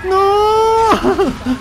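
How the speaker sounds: high loud shout of dismay. Real, not acted. Player shouting as his shot misses, loud dismayed yell. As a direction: scream no as it goes wrong. Crash in game.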